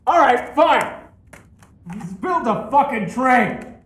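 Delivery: exasperated outburst; loud exasperation. Real, not acted. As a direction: give in loudly, thoroughly fed up. Exasperated while assembling train.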